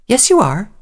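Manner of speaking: high little filly voice; insistent child. A narrator's reading. Filly contradicts her mother, childishly persistent. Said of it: "the filly persisted".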